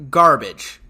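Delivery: a low, loud one-word put-down; blunt verdict. Real, not acted. Reviewer slams the show, barking a loud low garbage. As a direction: spit out the word like a final judgment.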